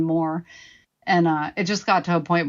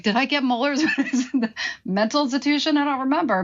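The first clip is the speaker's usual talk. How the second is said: incredulous laugh; 7 semitones higher, faster than usual. Retold or imitated. Incredulous retold thought with laugh.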